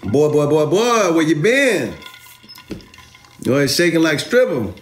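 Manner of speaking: movie quote. Retold or imitated. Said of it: "that's from the movie".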